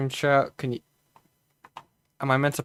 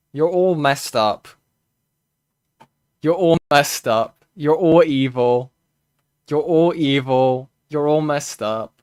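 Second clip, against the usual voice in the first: loud, slow, repeated scolding; mock angry scolding; 4 semitones higher, 8 dB louder than usual. Berating chat, repeated chant.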